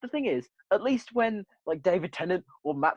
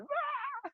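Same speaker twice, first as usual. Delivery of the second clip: hammed-up high cry, much higher and softer; mock cry; 9 dB quieter than usual. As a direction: mimic a bad actor's feeble shriek. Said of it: "these two actors going".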